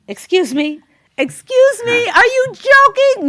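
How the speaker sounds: high, loud, indignant mock shriek; mock outrage. Wife answering husband's faint praise, indignant. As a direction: escalate into hammed-up disbelief.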